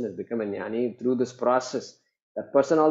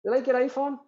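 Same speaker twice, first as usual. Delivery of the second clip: high teasing question; 11 semitones higher than usual. Retold or imitated. Sage quizzes his wife, high and teasing. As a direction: lift it up, a playful probe. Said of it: "And he says".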